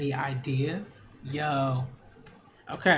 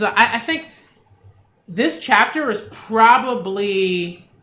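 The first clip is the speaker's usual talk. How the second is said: higher and louder, worked-up exclaiming; excited outburst; 4 semitones higher, 7 dB louder than usual. Real, not acted. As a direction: gush about it loudly. Outburst then excited reaction.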